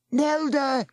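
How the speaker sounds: high, carrying call; shouting a name. A narrator's reading. Boy searching the quaking beach for Nelda, shouting. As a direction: call out across the shaking shore. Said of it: "he shouted".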